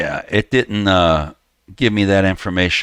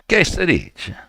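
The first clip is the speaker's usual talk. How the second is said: put-on Italian-American accent; 10 semitones higher, slower than usual. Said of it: "every time he says".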